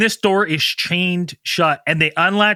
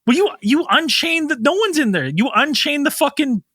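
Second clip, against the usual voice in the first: high-pitched, exasperated comic protest; incredulous; 6 semitones higher than usual. Retold or imitated. Protesting at men who unchained the door, incredulous. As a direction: point out the absurdity in disbelief. Arguing with the show.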